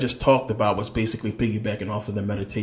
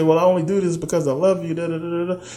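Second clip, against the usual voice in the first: higher, fast mocking singsong of a jealous partner; mocking quote; 8 semitones higher, faster than usual. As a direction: ape a whiny excuse, then trail into da da. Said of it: "people say".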